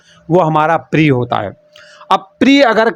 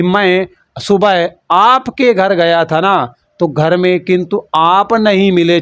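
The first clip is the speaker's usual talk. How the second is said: very slow, respectful reading of the corrected line; polite sentence read slowly; slower than usual. Shown as a demonstration. Teacher showing students the polite sentence, slow and measured. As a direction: model the courteous version word by word. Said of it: "संस्कार से बात किया है".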